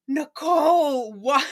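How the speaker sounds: can't believe she said that; shocked disbelief. Real, not acted. Host reacting to Nicole's servant comment, stunned and incredulous.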